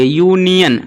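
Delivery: "यूनियन" split as yoo-nian; teacher showing the opening sound of "यूनियन"; pronunciation demonstration. Shown as a demonstration. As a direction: hold the "yoo" at the start of "यूनियन". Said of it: "पहला जो उच्चारण हो रहा है यू से".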